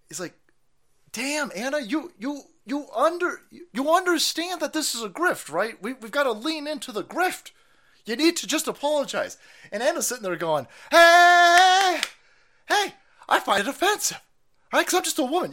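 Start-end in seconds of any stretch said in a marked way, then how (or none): 1.1-9.4 higher, lightly hammed-up scolding; a hint of a smug colleague explaining the grift; mock lecturing host. Yogurt lecturing Anna to apologize, mock scolding. 10.9-15.5 high, loud hammed-up indignant whine; mock offended woman. Anna protesting to her co-host, mock indignant and high.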